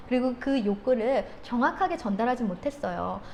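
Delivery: ordinary talk, nothing marked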